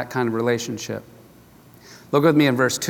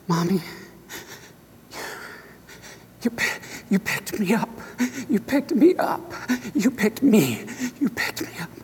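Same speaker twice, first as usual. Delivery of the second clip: put-on choked up, high halting voice of a sobbing child; broken, gasping retelling of a crying child; tearful child; 7 semitones higher, 5 dB quieter than usual. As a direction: imitate a little boy getting words out between sobs. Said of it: "he's just crying and crying".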